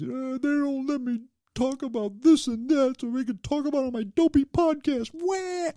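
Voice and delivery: high, loud whining crybaby voice ending in a wail; mock crybaby. Mocking a butthurt complainer, high whiny wail. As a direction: whine like a hurt child, then bawl. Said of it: "I'm not crying because somebody hurt my feelings".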